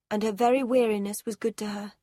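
usual speaking voice

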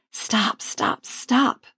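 breathless unvoiced whisper, quick repeats; whispered stop. Panicked girl whispering to herself, barely voiced. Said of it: "I repeat, this time in my head".

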